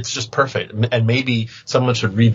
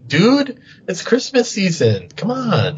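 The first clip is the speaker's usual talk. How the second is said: high, indignant protest; 9 semitones higher, slower than usual. Real, not acted. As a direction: plead your case, pitch up. Defends his Christmas music.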